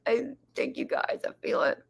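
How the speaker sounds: hushed, whispery mock-touched thanks; heart-face gratitude. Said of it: "that is the heart face".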